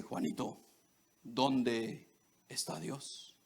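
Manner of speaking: a hushed, solemn pastor asking again; hushed preacher voice. Retold or imitated. Pastor repeating the question to the boy, hushed and slow. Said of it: "Y le dice".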